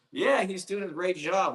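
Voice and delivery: high, disbelieving repeat of "Yeah, he's doing a great job"; mock echo. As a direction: parrot it back with incredulous irony. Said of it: "Like, who, I didn't".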